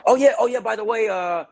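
high-pitched mock casual agent, easing down; offhand agent. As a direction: imitate an agent tossing out news as an afterthought. Mimics agent's offhand news.

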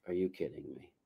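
low, very soft incredulous aside; retold under-the-breath reaction; hushed disbelief. Reacting in disbelief to friend's story.

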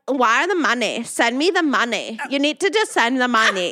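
mock fake-German heiress accent, demanding; odd, put-on heiress accent; Anna Delvey accent. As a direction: imitate a weird European heiress accent, insistently. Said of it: "She had the weirdest accent".

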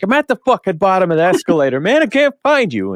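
high, fast, exasperated yell; frantic yelling. Retold or imitated. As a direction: blurt it out, lost and panicking. Frustrated on phone, can't find friend.

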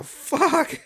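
high, sudden real curse; pained yelp. Real, not acted. Host has just hit their head, high pained curse. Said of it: "I hit my head".

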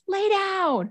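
high, mock-angry order; furious. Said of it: "he was furious".

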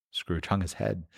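plain reading voice

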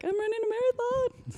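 high, soft, joyful exclaim; gleeful with a big grin; elated. Retold or imitated. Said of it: "But I was like".

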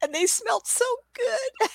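very high, slow, whining rapture; squealed, drawn-out doggy longing; salivating dog's voice. Acted. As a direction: swoon like a dog drooling over shoes. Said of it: "that dog could just salivate thinking".

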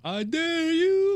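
high, drawn-out taunt; hammed-up squealed dare; mock dare. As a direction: stretch out a playful high-pitched challenge. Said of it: "Remember you told me that".

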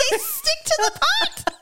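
high-pitched mock epiphany; amazed realization. Retelling co-host's pot sticker epiphany, amazed. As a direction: exclaim it like a huge discovery.

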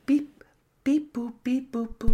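high robotic beep-boops; robot saying binary. Pretend. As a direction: chant beeps like a computer. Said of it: "let's hit the randomizer".

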